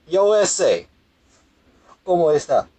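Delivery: high, loud hammed-up Mexican-Spanish accent, Spanish accent deliberately overdone as a joke; showing listeners a bad joke Spanish accent, hammed-up and high; mock Spanish accent. Shown as a demonstration. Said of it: "for Spanish, just throw up".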